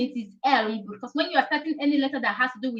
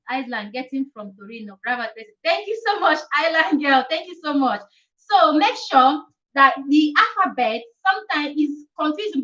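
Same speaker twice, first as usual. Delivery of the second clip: normal talking voice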